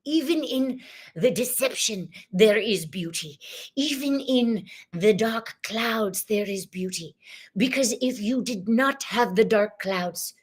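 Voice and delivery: beam out as a cheerful cosmic guide; channeled Zach. Acted. Channeled spirit greeting the women, jolly and exuberant. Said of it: "welcome to Zach".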